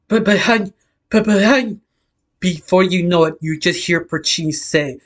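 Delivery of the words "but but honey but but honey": stammering. A narrator's reading. Frozen Mino stammers reply.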